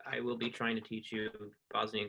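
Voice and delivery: ordinary talk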